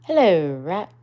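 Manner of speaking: Mole's bold hello; loud hello. Acted. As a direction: call it out across the water. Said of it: "said Water Mole".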